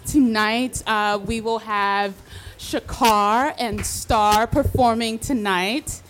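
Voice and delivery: ordinary talk, nothing marked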